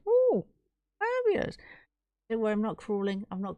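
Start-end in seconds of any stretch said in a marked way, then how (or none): 0.1-1.6 high squeal of delight. Real, not acted. Streamer thrilled by a viewer's gift, high squeal. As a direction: gush over the gift, way up high.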